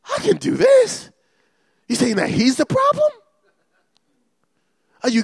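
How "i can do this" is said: much higher, put-on boyish voice; David psyching himself up before Goliath; voicing young David. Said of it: "I said".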